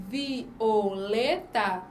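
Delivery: "Violeta" drawn out slowly with each vowel separate; slow syllable by syllable. Shown as a demonstration. Teacher slowly sounding out Violeta for the class. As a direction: stretch "Violeta" so the hiato shows. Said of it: "tá separada essas vogais aqui".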